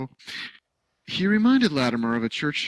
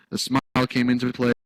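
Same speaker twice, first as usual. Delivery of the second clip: ordinary delivery, nothing marked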